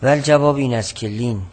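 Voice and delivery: ordinary talk, nothing marked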